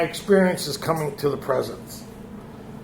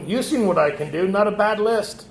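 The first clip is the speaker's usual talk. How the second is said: normal talking voice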